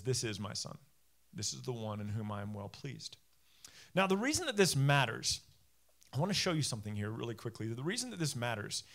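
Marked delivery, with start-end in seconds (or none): 0.0-3.2 lower, softer solemn voice from heaven; a hushed, lowered voice of God speaking over the water; quiet voice from heaven, pitched lower. Retold or imitated. Voice from heaven at baptism.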